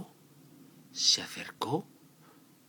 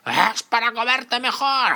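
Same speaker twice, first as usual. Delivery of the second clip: loud, high wolf reveal; menacing roar; 12 dB louder, slower than usual. Acted. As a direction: pounce on the words.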